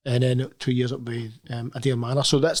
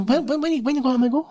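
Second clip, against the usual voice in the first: whiny pestering; 12 semitones higher than usual. Retold or imitated. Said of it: "I know".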